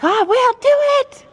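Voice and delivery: eager horse voice. Retold or imitated. Mares voiced as eager to do activities, high and loud. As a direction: voice the mares as keen and ready. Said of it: "They're like".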